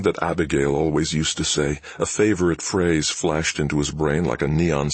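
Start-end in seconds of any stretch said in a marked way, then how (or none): none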